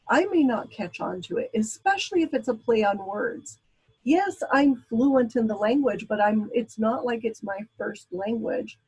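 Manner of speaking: ordinary talk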